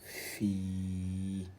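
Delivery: "fi" with the i held long; elongated vowel. Shown as a demonstration. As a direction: stretch out the vowel of "fi", slowly. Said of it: "et l'allongement, donc ça devient fi".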